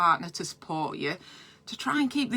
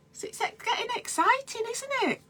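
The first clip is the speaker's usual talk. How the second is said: excited squeal; 4 dB quieter than usual. Real, not acted. Excited chat to viewers.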